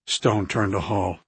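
ordinary delivery, nothing marked

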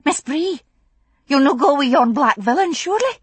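put-on a frightened young maid brave enough to shout; a frightened Scots maid challenging the stranger; high-pitched scared girl. A narrator's reading. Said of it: "Her high-pitched voice rang out".

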